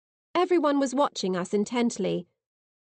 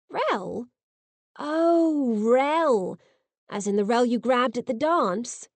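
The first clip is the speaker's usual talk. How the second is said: put-on teasing boy; 2 semitones higher, slower than usual. A narrator's reading. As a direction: tease her knowingly. Fraser teasing, knowing.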